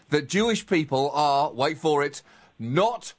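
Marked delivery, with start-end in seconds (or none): none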